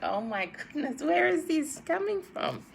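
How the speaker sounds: put-on a mother caught off guard by her child; recalled shock, voice jumping up; shocked dismay. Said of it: "I'm like".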